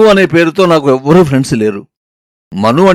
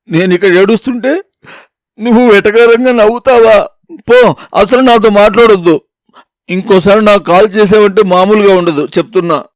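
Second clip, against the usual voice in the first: angry outburst; 6 semitones higher than usual. Acted. She yells at her fiancé for laughing at her tears, furious. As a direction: tear into him and hang up. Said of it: "ఇంకో నాలుగు తిట్లు తిట్టి".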